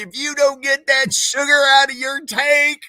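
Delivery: high, loud shout of the sergeant's taunt; drill sergeant scream. Retold or imitated. Retelling the sergeant's insult to the friends, high loud scream. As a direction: yell it like a furious sergeant. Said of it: "Screamed at me".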